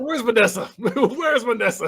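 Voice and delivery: high, dismayed mock disgust. Patient asking for Vanessa instead, disgusted. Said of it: "He's like all disgusted".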